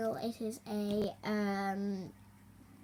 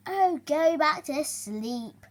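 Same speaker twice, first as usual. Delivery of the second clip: snappy; 4 semitones higher, 7 dB louder, slower than usual. Acted. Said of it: "snapped Shrew".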